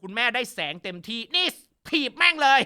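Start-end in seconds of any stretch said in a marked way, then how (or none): 1.3-2.6 imitating a higher, louder order to kick; mock fierce command. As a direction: bark it like an angry mother. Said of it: "คุณแม่บอก". (เลียนเสียงสั่งดุๆ สูงและดังขึ้น)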